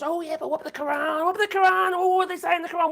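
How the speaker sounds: whiny mock outcry, pitched far up; mocking critics. Streamer mimics people asking about the Quran, mocking. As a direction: mimic indignant objectors in a high, rising voice.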